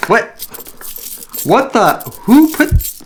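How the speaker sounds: high, loud startled outburst; shocked surprise. Acted. Vlogger finds an unexpected tool, shocked and loud. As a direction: jolt up in disbelief at what you found.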